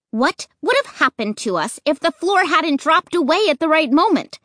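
demanding. Acted. Narrator confronting Luke, demanding. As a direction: press him for an answer. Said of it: "I demanded".

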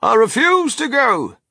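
high, loud, stubborn declaration; defiant old grandpa at full volume; loud announcement. A narrator's reading. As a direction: proclaim the refusal forcefully. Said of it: "announced Grandpa George".

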